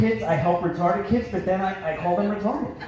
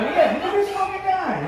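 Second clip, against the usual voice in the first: mock Philly jeer; 10 semitones higher than usual. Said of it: "The guy".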